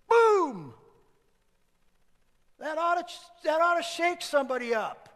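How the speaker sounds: very loud, high burst of Boom; explosive shout. Real, not acted. Preacher blasting Boom at the congregation. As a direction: explode the word and keep the volume up.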